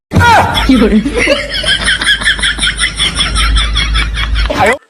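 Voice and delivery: a long, high, piercing cackle of laughter; loud shrieking laugh. Acted. Mother-in-law laughs off her test of the bride, loud shrieking laugh.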